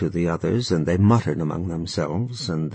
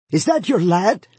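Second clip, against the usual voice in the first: higher, louder uncle's cry; 11 semitones higher, 4 dB louder than usual. A narrator's reading. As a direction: call out in a higher old uncle voice. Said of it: "cried the uncle".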